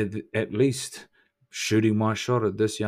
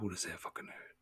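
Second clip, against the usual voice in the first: taunting whisper; 3 semitones lower, 20 dB quieter than usual. Acted. Taunting the listener.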